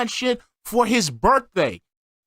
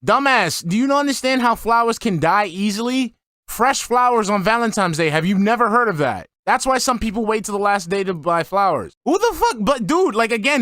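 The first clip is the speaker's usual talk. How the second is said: exasperated rant; 3 semitones higher than usual. Real, not acted. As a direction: tear into him in disbelief. Yelling at Phil over flowers.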